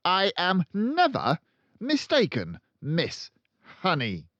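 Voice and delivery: loud, high barking headmistress; booming tyrant headmistress; barking Trunchbull. A narrator's reading. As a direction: bark it out like a bully. Trunchbull retorts.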